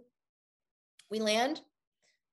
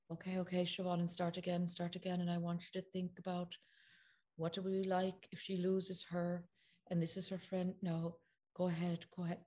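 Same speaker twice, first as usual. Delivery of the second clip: softer, gentle director talking over the take; 3 semitones lower, 8 dB quieter than usual. Retold or imitated. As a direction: coax the actress quietly. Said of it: "he has very gentle voice".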